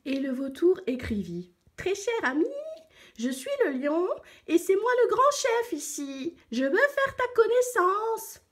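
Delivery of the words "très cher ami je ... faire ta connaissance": very high, put-on vulture voice; self-important vulture letter. A narrator's reading. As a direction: proclaim the letter in a high-pitched voice. Vulture writes as the lion. (vautour pompeux, voix très aiguë)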